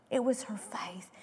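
hushed emphatic whisper. Real, not acted. Preacher stressing faith to the congregation, whispered. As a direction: drop to a whisper on "It was her faith".

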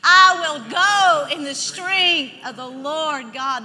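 very loud, slow group recitation lead; bold declaration. Shown as a demonstration. Preacher asking the congregation to say it along, loud and bold. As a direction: lead the room word by stretched word. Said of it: "Say that with me".

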